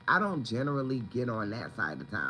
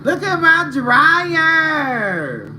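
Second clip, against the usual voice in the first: excited sing-song; 14 dB louder, much slower than usual. Real, not acted. Showing off her new dryer.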